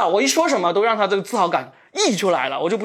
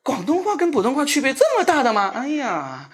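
ordinary delivery

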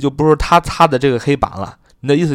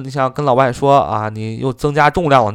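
normal talking voice

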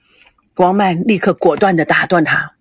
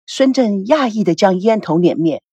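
ordinary delivery

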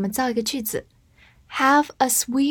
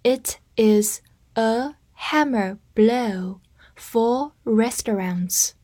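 slow, word by word; slow read; slower than usual. Shown as a demonstration. Teacher reads slowly for learners to repeat after her. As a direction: read it slowly for students to follow. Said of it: "跟着我慢读一遍".

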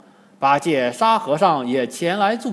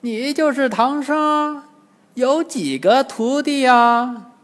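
put-on high, slow old demon voice; old demon questioning; 9 semitones higher, 3 dB louder, slower than usual. A narrator's reading. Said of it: "金蝉老妖来到唐僧面前说". (老妖拖长声音高声盘问)

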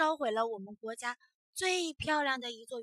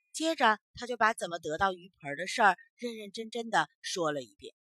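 ordinary delivery

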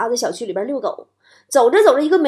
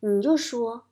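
soft, slow, confidential; acted-out kid sharing a secret, hushed and measured; lowered voice; 2 semitones lower, 5 dB quieter than usual. Boy to his brother-in-law about his birth, hushed. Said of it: "压低声音说".

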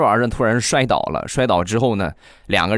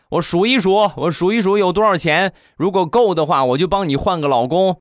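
put-on high little boy voice, indignant and raised; a kid scolding his uncle in a raised voice; cheeky child; 9 semitones higher than usual. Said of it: "说完他就说". (孩子气呼呼的高声数落)

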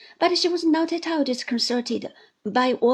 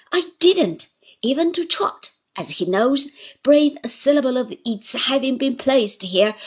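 put-on loud, forceful old aunt; aunt's indignant speech; 5 dB louder than usual. Aunt explains to Agnes, loud and indignant. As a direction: lay out the case with fire.

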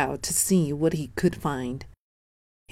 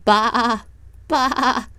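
higher bleating baa sounds; sheep bleating; 6 semitones higher than usual. Acted. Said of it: "said the sheep".

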